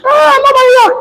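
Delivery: high, loud mocking whine, laughing; mock Buhari. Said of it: "You go say".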